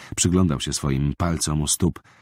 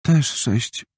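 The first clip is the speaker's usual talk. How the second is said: usual speaking voice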